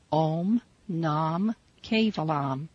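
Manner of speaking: slow "Om Nam Kevalam", long vowels; careful teaching demo of "Om Nam Kevalam"; mantra pronunciation. Shown as a demonstration. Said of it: "listen to the pronunciation of the mantra".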